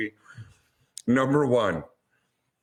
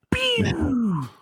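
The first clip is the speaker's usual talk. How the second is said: a quick high zap noise, lightly done; mock pew sound. Ranting about the movie's quick kill, a mock high pew. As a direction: toss off a little blaster sound effect.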